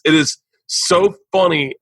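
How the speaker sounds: ordinary talk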